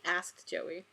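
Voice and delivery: plain reading voice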